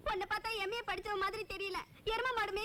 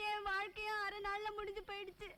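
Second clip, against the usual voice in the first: soft, weepy lament; tearful; 6 dB quieter than usual. Acted. Jilted bride mourning her half-day marriage, tearful. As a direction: quietly cry it out. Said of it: "நீ ஏம்மா அழற".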